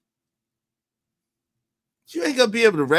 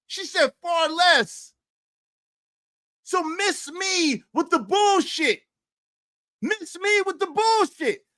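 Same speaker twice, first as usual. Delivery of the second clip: much higher, heated, preacherly indignation; indignant rant; 9 semitones higher than usual. Real, not acted. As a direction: rant at them, pitched up.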